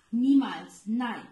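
flat-out loud no; shocked shout of refusal. Acted. Fish refuses outright.

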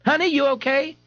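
very high anxious call; worried mother. Acted. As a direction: check on him, frightened. Mother after crash.

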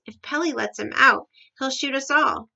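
loud, urgent alarm; shouted warning. A narrator's reading. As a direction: call out the danger to all. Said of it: "The giraffe shouted".

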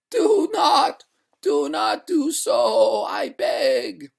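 high, loud, drawn-out wail; ghost crying out. A narrator's reading. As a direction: beg in a slow, piercing wail. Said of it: "cried the ghost".

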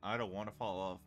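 ordinary talk

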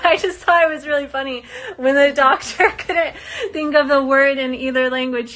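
amused, laugh your way through the story. Real, not acted. Amused recalling the doctor.

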